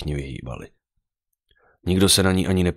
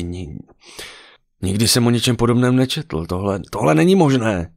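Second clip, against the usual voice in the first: stammering disbelief building to a raised, higher voice; baffled protest growing louder; disbelieving, finally yelling; 5 semitones higher, 3 dB louder than usual. A narrator's reading. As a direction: start incredulous, end in a loud cry. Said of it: "Zařval nakonec".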